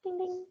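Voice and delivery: bell ding. Pretend. Ringing the magic bell.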